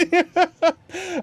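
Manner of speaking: high loud laugh. Real, not acted. Streamer laughing at her own cat story. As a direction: burst out laughing.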